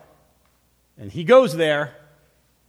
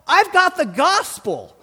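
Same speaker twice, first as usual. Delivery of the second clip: loud, high, slow proclamation; preaching; 14 semitones higher, 9 dB louder, slower than usual. Real, not acted. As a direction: proclaim it to the church at full force. Preaching at full force.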